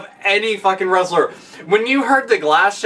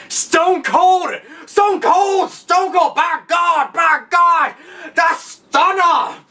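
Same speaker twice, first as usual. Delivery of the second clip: much higher, screaming commentator; imitating the wild commentator losing it at the entrance; frantic announcer; 10 semitones higher, much slower than usual. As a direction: lose your mind calling the entrance. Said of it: "J R would seriously lose his fucking shit".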